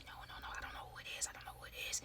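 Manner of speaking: fast, soft, voiceless denial; frantic whisper. Retold or imitated. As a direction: whisper the no's quickly and scared. Friend answers hiding in closet.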